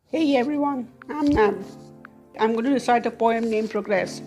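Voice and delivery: ordinary delivery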